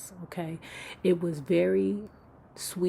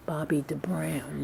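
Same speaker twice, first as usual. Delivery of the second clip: ordinary talk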